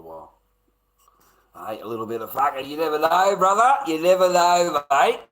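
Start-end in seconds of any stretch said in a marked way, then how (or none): 2.3-5.2 much louder and higher, fast start; loud, high, gleeful shout; excited shout. Real, not acted. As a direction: call it out full of glee. Excited shout about a Sheila.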